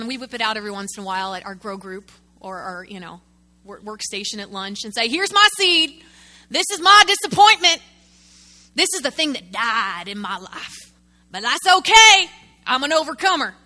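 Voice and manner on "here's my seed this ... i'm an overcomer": loud, high mock lament over a dead seed; self-pitying believer. Preacher mocking a churchgoer who shows off his failure, loud mock self-pity. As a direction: ham up the brave martyr showing off his failure.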